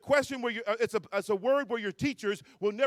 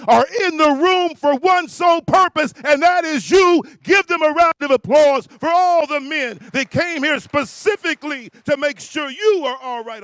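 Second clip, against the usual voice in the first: shout; 6 semitones higher, 12 dB louder than usual. Real, not acted. Hyping crowd, calling for applause.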